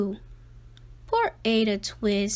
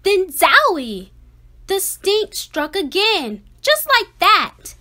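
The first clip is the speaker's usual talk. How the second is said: excited exclamation; 6 semitones higher, 7 dB louder than usual. A narrator's reading. Narrator reading a picture book, building to an excited burst. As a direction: burst out louder and higher. Narrator dramatizes stink attack.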